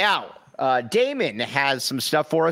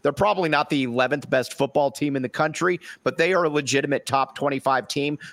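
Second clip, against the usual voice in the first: ordinary talk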